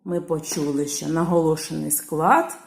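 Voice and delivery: ordinary talk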